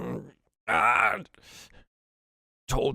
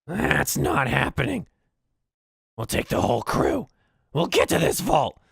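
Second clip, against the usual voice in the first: goblin boss; 9 semitones higher, 8 dB louder than usual. Acted. Goblin boss overruling the lackey, high and loud. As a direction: shut the idea down hard. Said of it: "she goes".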